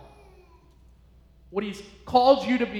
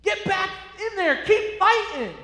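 high, loud shout to get back up; rousing, shouted encouragement; God as coach shouting; 10 semitones higher, 8 dB louder, slower than usual. Acted. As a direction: yell like a corner man urging a fighter. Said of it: "And he's saying".

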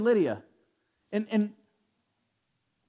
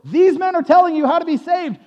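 loud, raised proclamation; slave girl's cry; 7 semitones higher, 7 dB louder than usual. Acted. Preacher quoting the slave girl's refrain, calling out. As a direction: proclaim it higher and louder.

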